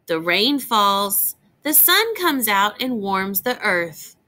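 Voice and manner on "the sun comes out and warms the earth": ordinary talk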